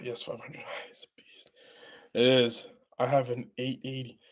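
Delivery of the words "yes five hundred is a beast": whisper. Real, not acted. Reading chat under breath.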